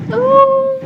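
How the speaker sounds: airy, high-pitched laughter; a soft, high, touched laugh; breathless high laugh. Real, not acted. Moved, laughing at sweet story.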